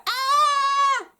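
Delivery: high, loud panicked shriek; high terrified squeal; goblin scream. Acted. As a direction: shriek at every word. Prince keeps screaming.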